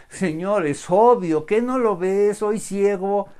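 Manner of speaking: exasperated, loud and high; indignant. Acted. Blind man answering Jesus with exasperation. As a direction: sound fed up with the obvious.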